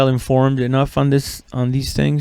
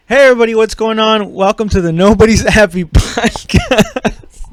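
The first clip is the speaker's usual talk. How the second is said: giggling through the welcome, a bit louder; can't keep a straight face on the intro; laughing through intro; 5 semitones higher, 5 dB louder than usual. Real, not acted. Cracking up during podcast intro.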